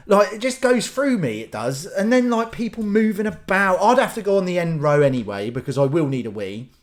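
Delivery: usual speaking voice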